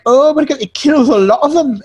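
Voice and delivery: high, loud mock Irish slipping into Scottish; botched Irish accent, sounding Scottish. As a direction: badly fake an Irish terrorist's accent. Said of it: "I know that's a Scottish accent".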